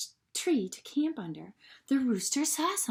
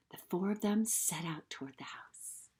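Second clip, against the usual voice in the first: hushed storybook whisper; whispered narration; 2 semitones lower, 10 dB quieter than usual. A narrator's reading. Narrator whispering as the animals creep closer. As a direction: whisper like sneaking up.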